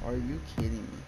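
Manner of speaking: usual speaking voice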